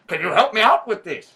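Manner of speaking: ordinary talk, nothing marked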